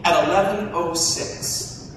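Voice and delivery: slow, weighty timestamp; slow ominous build-up; dramatic slow. Acted. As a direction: lay it out slowly for suspense. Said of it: "I will never forget that time".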